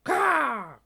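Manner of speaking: high, loud raven squawk; sharp bird call; raven caw. Acted. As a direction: caw like a crow. Raven Anna interrupts.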